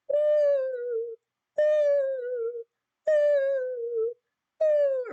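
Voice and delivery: high, loud ghostly ooh; spooky ghost ooh. Shown as a demonstration. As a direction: moan it like a cartoon ghost. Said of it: "or whatever you choose to put on those".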